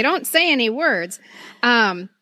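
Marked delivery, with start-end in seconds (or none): none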